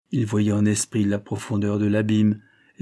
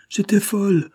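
shivering; 11 semitones higher, 5 dB louder than usual. A narrator's reading. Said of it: "en frissonnant sous l'étreinte".

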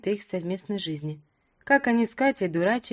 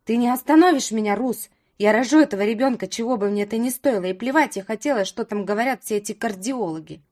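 loud, angry defiance; defiant shouting; 5 dB louder than usual. Acted. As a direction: refuse him at the top of your voice. Sister defies brother loudly.

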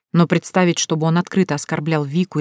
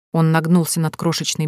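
usual speaking voice

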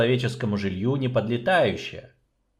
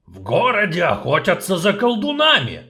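urgent announcement; 9 semitones higher, 5 dB louder than usual. Acted. Dwarf reports the witch hunt.

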